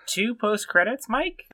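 very high, incredulous question; squeaky feigned shock; mock disbelief. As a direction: play along with exaggerated amazement. Co-host plays along, astonished.

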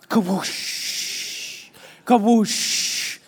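breathy whooshing flush sound; toilet flush noise. Retold or imitated. Teacher imitates the flushing toilet, breathy whoosh.

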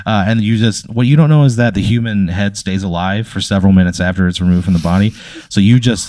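ordinary talk

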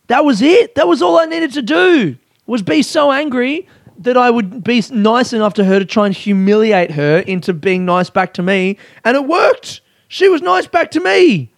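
real elated squeal of success; giddy triumph. Real, not acted. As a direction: crow about your victory. Triumph that the trick worked.

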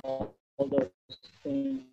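audio cutting out, dropping to near silence; choppy broken connection, words vanishing; corrupted glitchy audio. Real, not acted. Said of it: "Your, your audio's cutting out again here".

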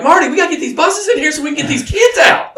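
high, urgent mock holler; pleading yell at a traffic cop. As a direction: call it out high over the buses. Acted shout to Marty.

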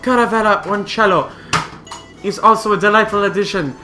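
upbeat commercial announcer; ad read. Acted. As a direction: sell it like a radio spot. Reads the liqueur ad.

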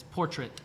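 "portrait" with the A almost gone, POR-tret; "portrait" with no ay sound in the second syllable; native-style pronunciation. Shown as a demonstration. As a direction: say "portrait" ending in a short, reduced -tret. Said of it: "la A es casi inexistente".

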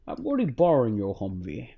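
a hint of Connery's Scottish burr, quiet and dry; Sean Connery impression. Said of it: "That's a good one".

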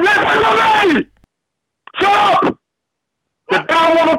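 high, slow furious shout; pompous shrill tyrant. Real, not acted. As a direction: yell them down, pitch climbing. Angry father shouting down caller.

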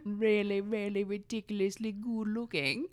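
hammed-up male model voice; Zoolander impression. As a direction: preen it like a vain male model. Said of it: "That was half Zoolander".

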